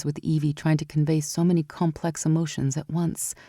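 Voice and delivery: ordinary delivery, nothing marked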